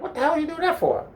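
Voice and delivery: very high, fast protest; hammed-up indignant question; mock outrage. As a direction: squeal it in disbelief, rushing. Indignant after surprise French kiss.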